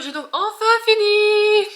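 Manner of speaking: loud, high, drawn-out cry of relief; triumphant announcement. Real, not acted. Reader telling viewers the book is finally done, triumphant shout. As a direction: belt it out slowly in triumph.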